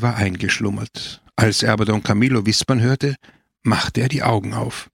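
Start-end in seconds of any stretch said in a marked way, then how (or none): none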